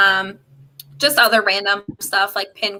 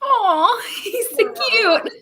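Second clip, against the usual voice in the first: cooing at dog; 12 semitones higher, slower than usual. Real, not acted. Cooing at a puppy.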